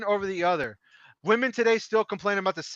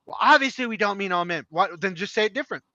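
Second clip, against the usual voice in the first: ordinary talk, nothing marked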